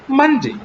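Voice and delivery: "Monday" high and loud on MON; teacher's model "Monday", first syllable punched; exact pronunciation. Shown as a demonstration. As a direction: stress the start of "Monday", steep fall after. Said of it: "word in exact pronunciation".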